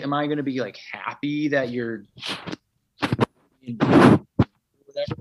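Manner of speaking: husband mimicking his wife explaining herself; wife's voice. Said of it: "And she's like".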